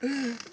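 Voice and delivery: high breathy sob; a real sob fading. Real, not acted. Crying at brother's memory.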